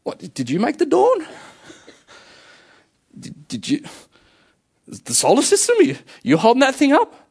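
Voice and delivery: high-pitched incredulous put-down; God mimicking the confronting director; director-style challenge. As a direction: challenge him in a high, mocking voice. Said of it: "the same way that the director spoke".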